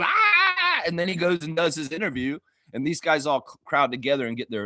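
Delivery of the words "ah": sudden high yelp, hammed up; Darko's yell. Darko yelling as the team celebrates, high mock yell. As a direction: let out a short mock battle yell. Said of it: "Darko yells".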